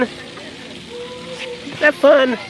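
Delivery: very slow, stretched question. Real, not acted. Slow drawn repeat to baby.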